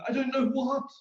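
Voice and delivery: high, loud, upset; upset demand. Retold or imitated. Demanding answers from his friend, upset and loud. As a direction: demand it sharply, high and agitated. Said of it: "I'm getting kind of upset".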